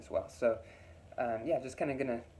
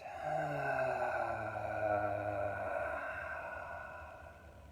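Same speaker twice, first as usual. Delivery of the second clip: very long, audible exhaling sigh; releasing sigh. Shown as a demonstration. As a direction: release all the breath in a slow sigh. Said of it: "releasing out".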